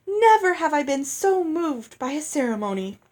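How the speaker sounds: high, loud theatrical proclamation; a fairy performing her delight; grand gushing speech. A narrator's reading. Lucinda declaiming to the wedding hall, high and theatrical.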